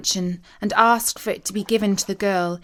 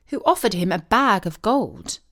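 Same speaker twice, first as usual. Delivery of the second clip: plain reading voice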